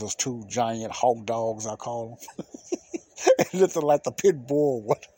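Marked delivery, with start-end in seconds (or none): 2.5-3.3 high laugh. Real, not acted. Laughing at his Hulk dogs.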